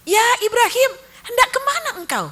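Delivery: high, loud pleading wife; Hajar's anxious plea. Acted. Wife begging her husband where he is going, high and anxious. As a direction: call after him, high and worried.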